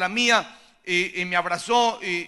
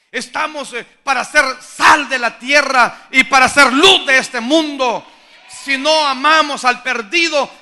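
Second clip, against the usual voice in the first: loud, high, urgent preaching; rally the church to love the lost; fiery preaching; 4 semitones higher, 7 dB louder than usual. Real, not acted. Preacher exhorting the church, at full force.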